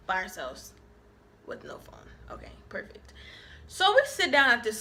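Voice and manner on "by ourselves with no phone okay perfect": whispered, deadpan okay perfect; sarcastic whisper. Real, not acted. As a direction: mutter it under your breath, fed up. Sarcastic aside, phone died.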